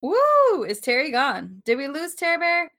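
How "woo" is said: loud, high whoop; high woo cheer. Real, not acted. Host whoops at cohost's joke, high and loud. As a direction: let out a party whoop.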